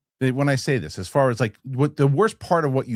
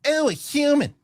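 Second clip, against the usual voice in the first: snide mocking woman; 10 semitones higher than usual. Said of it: "She was horrendous".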